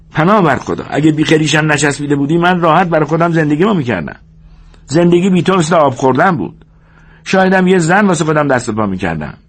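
high-pitched complaining minder; George, exasperated. Acted. George complains Lennie is a burden, exasperated. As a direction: grumble at him, pitched high.

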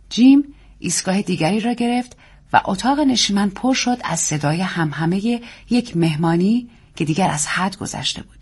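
plain reading voice

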